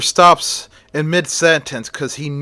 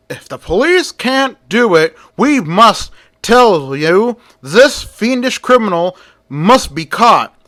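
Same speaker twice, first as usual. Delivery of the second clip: higher, louder orator voice; reformer's speech; 6 semitones higher, 3 dB louder than usual. Acted. Edgar Martin rousing the crowd about the criminal. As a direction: rouse the crowd from the podium.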